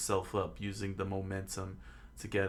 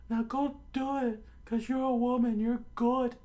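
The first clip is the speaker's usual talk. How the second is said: loud, very high falsetto argument; high-pitched hammed-up fear; scared coward; 4 dB louder than usual. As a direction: squeal excuses like a cartoon coward.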